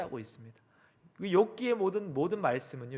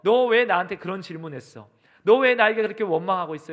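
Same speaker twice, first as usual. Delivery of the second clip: loud scolding questions; hypothetical divine rebuke, pushed louder; acted reproach; 4 semitones higher, 10 dB louder than usual. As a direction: take Job to task in a raised voice. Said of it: "책망의 말씀을 하시는 것이".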